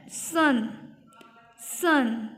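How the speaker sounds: "सन" with a clear uh in the middle; teacher modelling the vowel in "सन"; short uh sound. Shown as a demonstration. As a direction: say "सन" as SUN, one word at a time. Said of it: "अ की sound आ रही है".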